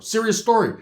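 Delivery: normal talking voice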